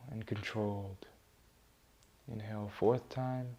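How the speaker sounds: low, very soft meditation voice; hushed, unhurried guide with long pauses between phrases; guided meditation. Acted. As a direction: lead them gently through each breath, low and quiet. Leads a breathing meditation.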